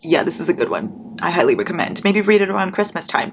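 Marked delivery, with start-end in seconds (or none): none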